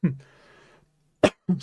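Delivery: coughing, choking on a sip; choking on water. Real, not acted. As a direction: splutter through a mouthful of water. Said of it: "I'm choking on my water".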